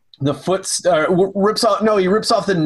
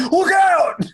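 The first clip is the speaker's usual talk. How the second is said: much higher mock yell of a warning; 13 semitones higher than usual. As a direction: yelp it out high like a rushed warning. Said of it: "yells at Jerry".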